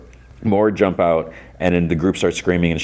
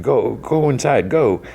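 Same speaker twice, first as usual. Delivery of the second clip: tag; 6 semitones higher, slower than usual. Retold or imitated. Hero urging townspeople to flee.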